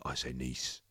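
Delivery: low Cockney "nice" said as "niece"; deep, soft dragon voice, "nice" like neece; put-on low voice; back in character. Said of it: "I've got broken character".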